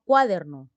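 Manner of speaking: "cuaderno" stressed on the first syllable, CUA-der-no; mis-stressed "cuaderno", first beat strong as CUÁ-derno; wrong first-syllable stress. Shown as a demonstration. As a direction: punch CUA loudly in "cuaderno". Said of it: "Yo no digo cuaderno".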